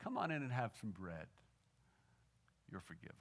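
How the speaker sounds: gentle, smiling invitation, soft and low; tender, quiet words of forgiveness; Jesus. Retold or imitated. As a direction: welcome them softly, then hush for the pardon. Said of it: "Jesus saying with a gentle smile".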